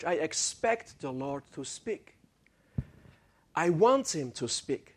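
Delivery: usual speaking voice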